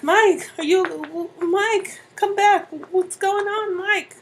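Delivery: high, loud, stammering cries; panicked pleading. Acted. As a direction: beg them to come back, frantic. Shaking stabbed husband to wake him.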